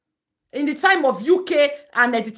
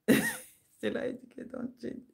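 whispered aside; 6 semitones higher, 11 dB quieter, slower than usual. Real, not acted. Aside comment, voice shifts.

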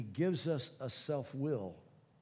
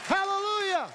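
high, loud exultant preaching, nearly shouting; preaching at full force; 13 semitones higher, 5 dB louder than usual. Acted. Pastor praising God before the congregation, shouting exultantly. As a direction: proclaim God's greatness to the congregation.

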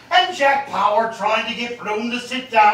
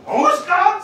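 indignant; 11 semitones higher, 5 dB louder than usual. Acted. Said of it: "says the citizen".

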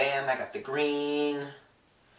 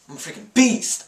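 excited; 9 semitones higher, 7 dB louder than usual. Real, not acted. Bragging after the pirouette.

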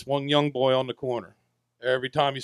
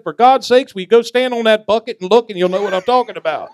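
put-on much higher and louder, fed-up old lady; exasperated old woman; 8 semitones higher, 8 dB louder than usual. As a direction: cry it out like an exasperated granny.

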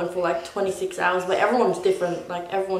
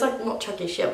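normal talking voice

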